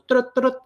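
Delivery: high mock radio beeping; quick high imitated radio chirp; walkie-talkie chirp.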